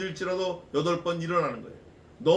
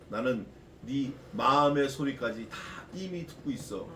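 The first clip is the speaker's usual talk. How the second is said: God's voice; 6 semitones lower, 4 dB quieter than usual. Acted. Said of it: "하나님, 전능하신 하나님이".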